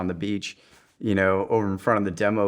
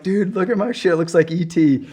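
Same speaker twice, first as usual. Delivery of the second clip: mock alarmed shout; 10 semitones higher, 5 dB louder than usual. Retelling showing buddies after Hell Week.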